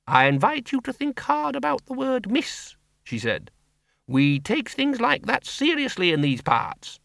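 put-on a blunt old farm woman talking plainly; Miss Flitworth. A narrator's reading.

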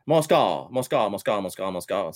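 mock lament dropping to a murmur; fading repetition. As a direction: let "mon score" sink and die away. Repeats the phrase, sinking away.

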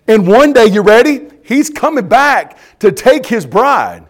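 loud, weighty preacher's delivery; emphatic preaching. Real, not acted. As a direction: preach it loud, drawing out the key words. Reads quote, preaches it forcefully.

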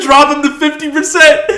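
high, loud mock order cracking into laughter; laughing mock order. Mocking the movie's scientists while laughing. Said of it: "They're like".

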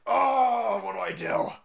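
despairing recruiter. Retold or imitated. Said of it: "He's like".

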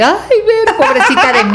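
high-pitched, hammed-up wail; mock crying self-pity. Imagining herself dumped by a partner, mock tearful self-pity. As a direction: play the poor little victim, laughter creeping in. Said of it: "yo estoy llore y llore".

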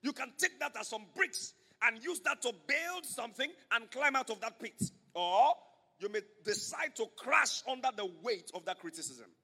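ordinary delivery

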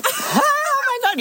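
high slow laughing cry; shrieking laugh. Real, not acted. Laughing at dolphin sound idea.

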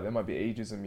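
usual speaking voice

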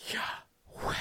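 whispered in-and-out breath sound, "yah... weh"; voiceless inhale and exhale; breathed name. Shown as a demonstration. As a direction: say Yahweh as nothing but breath. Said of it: "'Cause it takes a breath".

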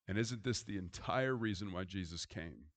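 ordinary talk, nothing marked

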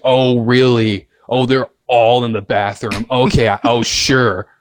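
slow, mocking doubt; the guard sarcastically doubting the kids; sarcastic disbelief. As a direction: drag it out like you don't buy a word. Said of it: "She's like".

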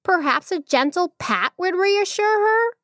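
high, gently persuasive monkey voice; calm little monkey. A narrator's reading. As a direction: coax the queen in a small, high voice. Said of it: "he replied calmly".

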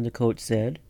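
plain reading voice

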